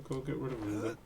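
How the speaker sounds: normal talking voice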